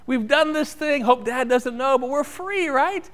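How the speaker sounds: put-on high, excited boyish glee; gleeful kids. Boys gloating after climbing the fence, high and gleeful. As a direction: crow about getting away with it. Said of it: "and they thought".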